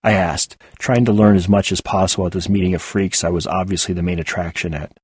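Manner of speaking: usual speaking voice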